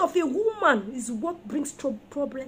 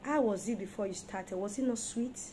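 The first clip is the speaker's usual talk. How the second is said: ordinary talk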